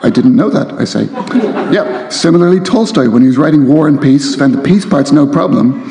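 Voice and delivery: reading aloud. A narrator's reading. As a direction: read the novel with relish to the crowd. Author reads novel excerpt aloud.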